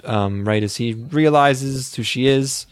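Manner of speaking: ordinary talk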